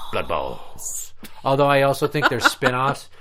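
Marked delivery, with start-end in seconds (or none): 0.1-1.1 deep movie title voice; low, growled title announcement; trailer title drop. Pretend.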